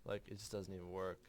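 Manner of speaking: skater surfer voice. Acted. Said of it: "maintain my skater surfer voice".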